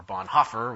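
Bonhoeffer with a sounded h, "BAHN-HOFF-er"; mistaken pronunciation. Shown as a demonstration. Lecturer offering another wrong version, Bonhoeffer as "bahn-HAH-fer". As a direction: pronounce the h in Bonhoeffer, open "hah-fer" ending. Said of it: "which isn't even better".

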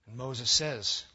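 usual speaking voice

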